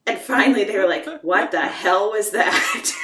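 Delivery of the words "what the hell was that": slow, drawn-out mock disbelief, breaking into a laugh; amused mock incredulity; retold bafflement. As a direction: imitate baffled colleagues, stretching the words out. Retold colleagues' baffled reaction, laughing.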